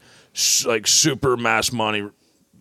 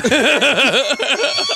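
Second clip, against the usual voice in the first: laughter; 12 semitones higher, 4 dB louder than usual. Real, not acted. Laughs at own punchline.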